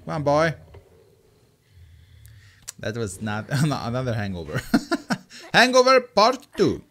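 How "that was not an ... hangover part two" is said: laughter breaking into the words; amused laughing. Real, not acted. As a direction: crack up at your own joke. Amused by own hangover joke.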